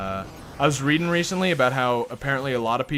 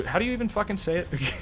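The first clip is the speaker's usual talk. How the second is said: normal talking voice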